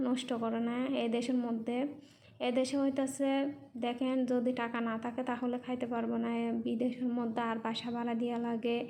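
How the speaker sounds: ordinary talk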